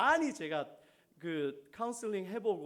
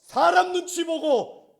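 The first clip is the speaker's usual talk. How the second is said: loud, high-pitched preacher's shout; full-force preaching; 9 semitones higher, 18 dB louder, slower than usual. Real, not acted. Preacher rebuking timid Christians, full-force shout. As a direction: drive every phrase out hard and high.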